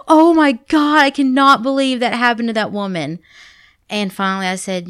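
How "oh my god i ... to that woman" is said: high, loud mock horror; hammed-up shocked outburst; freaking out. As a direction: imitate a kid aghast at a scary film. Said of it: "they were freaking out".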